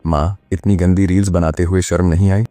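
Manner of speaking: usual speaking voice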